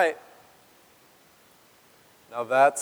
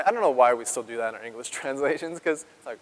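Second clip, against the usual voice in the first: ordinary talk, nothing marked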